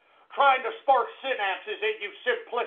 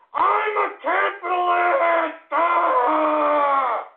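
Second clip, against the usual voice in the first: mock anguished wail; 5 semitones higher, much slower than usual. Host wailing about respect owed to a capitalist, hammed-up cry. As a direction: wail it like a wounded martyr.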